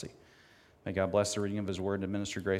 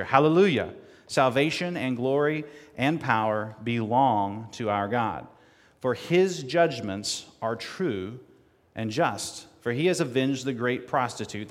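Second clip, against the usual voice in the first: hallelujah cried out loud, then measured proclamation; fervent scripture reading; 4 dB louder than usual. Acted. Preacher rereads the heavenly cry for the congregation, fervent proclamation. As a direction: proclaim the text again.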